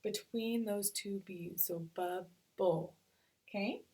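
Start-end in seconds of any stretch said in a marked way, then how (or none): 1.9-2.9 slow bubble in two beats; "bubble" pulled apart as bub... ble; split into syllables. Shown as a demonstration. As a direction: sound out bubble with a gap between the b's. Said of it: "I'm gonna break it apart".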